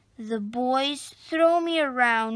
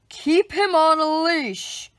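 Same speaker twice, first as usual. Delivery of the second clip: firm, louder telling-off; scolding; 5 dB louder than usual. A narrator's reading. Park keeper scolds the young man, firm telling-off. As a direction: scold the dog owner sternly. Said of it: "he scolds the young man".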